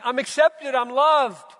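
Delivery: high, loud joyful cry; voiced joy. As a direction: burst out with relief and joy. Voicing a believer's joy.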